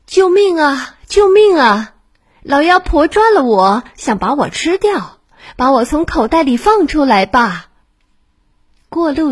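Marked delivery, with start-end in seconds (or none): 0.0-7.7 high, loud cries for help from inside a sack; shouting for help. A narrator's reading. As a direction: yell for rescue, muffled in a bag. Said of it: "在口袋里喊道". (从口袋里大声呼救)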